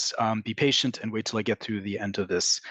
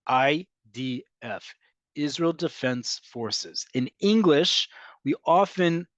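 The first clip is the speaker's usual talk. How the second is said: ordinary talk, nothing marked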